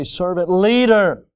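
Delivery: "leader" punched out after a lighter "servant"; stress on LEADER. Shown as a demonstration. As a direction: lean hard on the word "leader". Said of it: "We kinda get to the last part".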